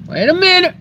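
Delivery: high, loud sudden alarm; startled. Real, not acted. Startled yelp while checking the porch for lizards. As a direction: yelp it as if something moved.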